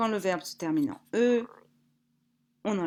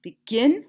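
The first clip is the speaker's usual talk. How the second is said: "Begin" with stress on GIN, louder and rising; stress on second syllable; 5 semitones higher, 8 dB louder than usual. Shown as a demonstration. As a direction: punch GIN in "Begin" for the class. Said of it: "accentué sur la deuxième".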